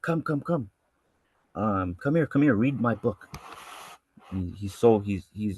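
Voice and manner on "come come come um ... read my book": lightly voiced happy believer, higher; joyful invitation. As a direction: call them over in mild delight. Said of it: "he's so happy".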